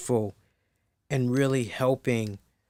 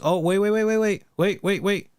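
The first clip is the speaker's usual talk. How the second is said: higher, louder, rapid-fire interruption; sudden self-correction; 6 semitones higher, 4 dB louder than usual. Real, not acted. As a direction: stop yourself urgently on a new discovery. Catches own mistake while checking facts.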